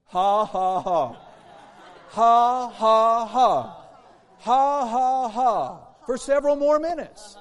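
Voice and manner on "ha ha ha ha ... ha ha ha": very loud, deliberate fake ha-ha-ha; forced laugh. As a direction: belt out each ha, building in volume. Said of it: "He went".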